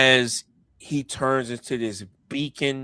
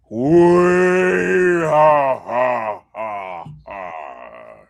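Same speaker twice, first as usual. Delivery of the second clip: loud, drawn-out whooping laugh; villain laugh; 10 dB louder, much slower than usual. Acted. Giant-ship admiral arriving, long loud whooping laugh. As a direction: start with a long whoop, then slow ha-has.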